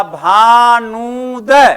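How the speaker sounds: भानुदय stretched very slowly, long heavy नू; stressed long नू. Shown as a demonstration. Teacher showing students the correct pronunciation of भानुदय, नू stressed and drawn out. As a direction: hold and press the नू in भानुदय. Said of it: "जब आप सही उसका उच्चारण करेंगे".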